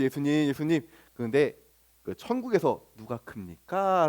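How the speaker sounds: a disciple asking shyly, half under his breath; timid disciple's question. Acted. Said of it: "라고 물어보는 거죠".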